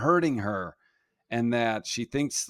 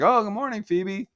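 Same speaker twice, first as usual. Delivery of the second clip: mock innocent dad; 8 semitones higher than usual. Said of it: "I was like".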